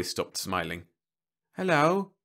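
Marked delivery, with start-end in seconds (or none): none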